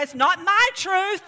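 insist, mockingly, it's your truth; mock indignant. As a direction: customer mock-arguing with the barista, mock indignant. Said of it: "I'm gonna say".

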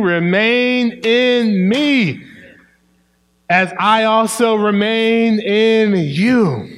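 slow, high, loud scripture proclamation; preaching at full force. Shown as a demonstration. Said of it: "I'm gonna say that again".

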